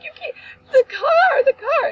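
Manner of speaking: very high, loud panicked cry; retold screaming. Daughter on the phone about the car, screaming. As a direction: choke out a re-enacted hysterical wail. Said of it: "now I'm screaming".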